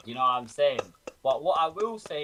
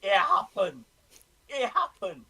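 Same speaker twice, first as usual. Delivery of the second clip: giddy relief; 7 semitones higher, slower than usual. Retold or imitated. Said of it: "And I was like".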